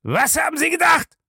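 very high, incredulous shout; outraged shout. A narrator's reading. Bellamy turns on the butler, shouting in outrage. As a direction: explode at him in outrage. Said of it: "fuhr Bellamy ihn an".

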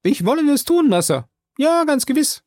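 high, eager servant voice; Jupiter answering quickly. Acted. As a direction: jump in fast and keen. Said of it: "antwortete der Schwarze schnell".

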